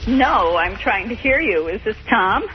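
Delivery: usual speaking voice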